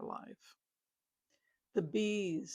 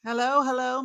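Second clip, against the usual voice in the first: big, loud showman hello; booming greeting; 5 semitones higher, 13 dB louder than usual. Acted. Opening greeting as showman persona.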